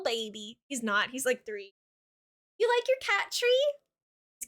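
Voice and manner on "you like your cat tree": much higher cooing question. Real, not acted. Asking her cat.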